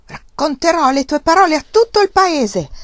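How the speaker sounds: high, loud, shrill threat; shrill furious threat. A narrator's reading. Uncle threatens to shame nephew, shrill fury. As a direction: screech it in fury. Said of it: "con voce stridula e tremante nella furia".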